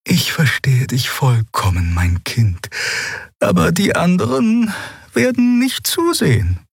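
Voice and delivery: sanfte alte Frauenstimme; sanft. A narrator's reading. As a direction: sanft und mütterlich, ansteigend. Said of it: "Sanft erklärte sie Lien". (sanft erklärend, mein Kind)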